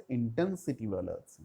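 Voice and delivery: ordinary talk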